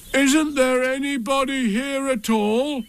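high, slow, pleading call. A narrator's reading. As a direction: call into the hole for any answer. Pooh calls into the hole again.

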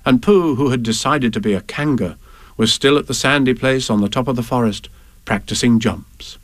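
usual speaking voice

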